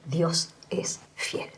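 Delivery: a soft, drawn-out whisper of conviction; closing her testimony, whispered slowly; hushed, slow declaration. Real, not acted. Solemn closing statement of faith.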